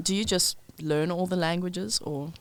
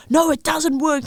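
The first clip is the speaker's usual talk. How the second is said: high, loud mock protest; mock indignant Dutch; 10 semitones higher, 8 dB louder than usual. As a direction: imitate offended locals shouting no, high and loud. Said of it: "They were like".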